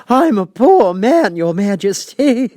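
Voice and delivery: high, loud, slow quavering plea; trembling Hatter. A narrator's reading. Hatter pleads with the King, trembling high and slow. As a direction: beg for mercy, voice shaking. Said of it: "in a trembling voice".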